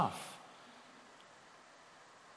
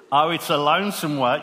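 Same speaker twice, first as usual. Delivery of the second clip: louder, hammed-up Australian accent; 3 semitones higher, 5 dB louder than usual. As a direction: recite it in a playful Aussie accent. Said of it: "say this in an Australian accent".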